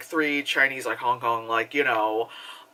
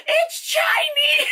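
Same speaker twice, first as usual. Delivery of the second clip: very high, drawn-out mock shriek; exclaiming old lady; 3 dB louder, much slower than usual. Imitating the old lady in the theater, shrieked exclamation. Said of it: "just her going".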